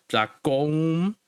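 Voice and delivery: Dlakoum with a lifted, stressed final u. Shown as a demonstration. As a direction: lean on the u in Dlakoum. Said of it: "you put that emphasis on the u".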